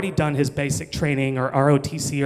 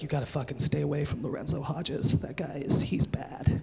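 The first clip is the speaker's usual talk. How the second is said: hushed warning; 10 dB quieter than usual. Retold or imitated. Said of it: "it was like".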